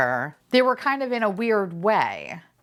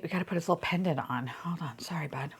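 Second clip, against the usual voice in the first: soft whisper to the toy; whispered apology to the toy; whispered aside; 2 semitones lower, 9 dB quieter, faster than usual. Real, not acted. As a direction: murmur it to the little figure.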